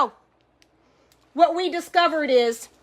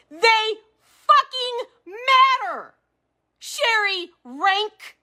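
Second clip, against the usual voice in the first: tearful anger; 6 semitones higher, slower than usual. Real, not acted. Grieving dead friends to Sherry Rank, crying out. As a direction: voice cracking into a cry, high and slow.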